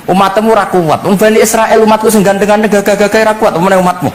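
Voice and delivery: high-pitched, insistent protest; arguing. Acted. Moses warning that the people are not strong enough, protesting. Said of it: "Bareng tok Nabi Musa bantah".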